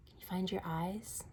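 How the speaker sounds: soft unvoiced question to the kids; hushed game question; whisper. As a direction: lean in and whisper it.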